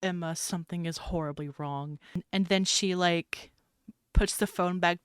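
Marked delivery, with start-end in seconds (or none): 0.0-2.0 understated fear. Acted. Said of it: "the look on Mad's face is just pure fear".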